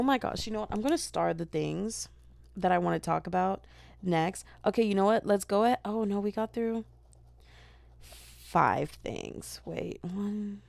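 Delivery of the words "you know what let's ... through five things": ordinary talk, nothing marked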